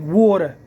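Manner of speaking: "water" said Philly style as wooder; Philly accent on "water". Shown as a demonstration.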